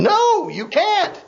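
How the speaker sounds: mock scolding retort. Playful retort to doubter.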